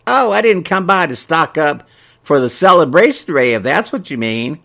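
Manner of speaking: lightly voiced elderly woman; prim old busybody; old gossip lady voice. Mabel voiced in story.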